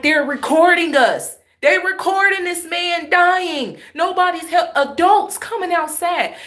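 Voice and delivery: high, loud outraged yell; snapping. Retold or imitated. Woman yelling at neighbors filming the dying man, high and outraged. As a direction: retell the snap at the onlookers, pitch up high. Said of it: "I was snapping the hell off".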